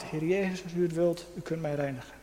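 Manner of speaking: veel zachter, ingetogen: „Heer Jezus, als u het wilt”; stil smekend gebed. Retold or imitated. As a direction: een innerlijke schreeuw, bijna fluisterend gebeden. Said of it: "een schreeuw van mijn hart". (de spreker bidt zacht zijn eigen hartenkreet)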